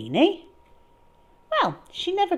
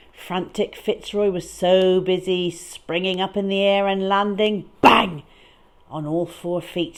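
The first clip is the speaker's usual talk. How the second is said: ordinary delivery, nothing marked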